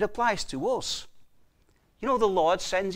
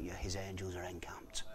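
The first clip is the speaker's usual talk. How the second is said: whisper; 11 semitones lower, 19 dB quieter than usual. Real, not acted. Preacher hushes the key promise.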